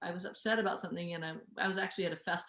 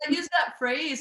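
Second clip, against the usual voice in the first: higher, louder words through laughter; laughing; 9 semitones higher, 8 dB louder, slower than usual. Real, not acted. Host laughing as she agrees about the phrase. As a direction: speak through the giggle.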